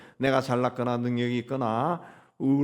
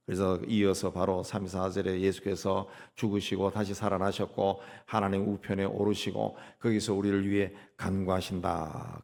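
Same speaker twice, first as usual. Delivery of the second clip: usual speaking voice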